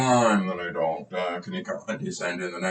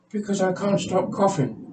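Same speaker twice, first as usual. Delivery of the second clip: "can't stop coughing" in a much higher put-on patient voice; 10 semitones higher than usual. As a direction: deliver the punchline as the squeaky patient. Patient delivers pun.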